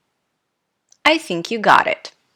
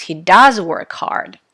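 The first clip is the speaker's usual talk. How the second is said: "does" punched louder; stress on does; 5 dB louder than usual. Shown as a demonstration. Teacher directing attention to the fact, stress on "does". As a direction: insist by hitting "does" hard. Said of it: "We want to emphasize".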